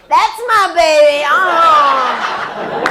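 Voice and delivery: a hammed-up proud husband. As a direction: beam with pride over your wife. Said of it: "the big, proud husband".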